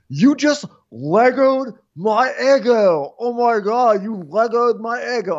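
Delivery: high, slow, hammed-up protest; mock outraged whine. Imitating Austin whose waffle bite was stolen, high protest. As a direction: stretch each word in high mock disbelief.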